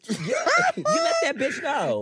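loud, laughing hype for her friend; laughing cheer. Real, not acted. Hyping up friend after her club story, laughing loudly.

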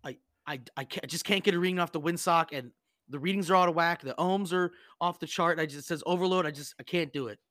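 put-on a defeated young sailor reporting failure; whiny kid. Hazed kid complains after an hour.

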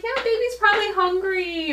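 put-on gently smooth it over for the child; soothing mom. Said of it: "I'm like".

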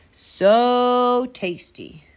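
loud, long-drawn relish; wolf gloating over his meal; savouring. A narrator's reading. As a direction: stretch the SO with greedy satisfaction.

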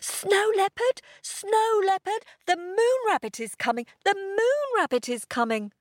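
high, breathless little dog voice; panting Pip. A narrator's reading. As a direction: pant it out, high and urgent. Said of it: "panted Pip".

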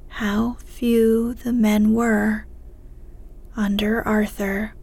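ordinary delivery, nothing marked

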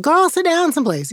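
high-pitched exasperated mom; scolding mother. Retold or imitated. Mother telling a leaping child to settle down, exasperated. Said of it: "my mother would have been like".

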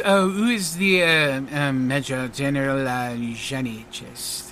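curious French showman, sinking low and soft; a hint of French stage magician; lightly voiced French magician. French magician asks after someone.

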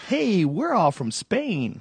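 high, slow hammed-up comic; mock comic voice. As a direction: ham up a cheesy comic. Mocking theme-show comics.

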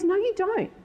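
high-pitched mock contradiction; bickering contradiction. Acting husband contradicting her.

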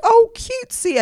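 high-pitched mock squeal of delight. Reacting to a late call time, delighted. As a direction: coo it way up high.

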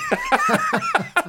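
a sudden squeal of laughter; high laughing burst. Real, not acted. Laughing at a memory.

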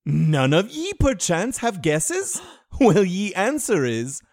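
loud, slow mock olde English; hammy stage actor. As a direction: boom it like a stage ham. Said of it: "We were speaking dumb to remind you all about the olden times".

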